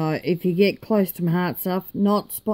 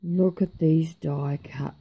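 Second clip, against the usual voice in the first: ordinary talk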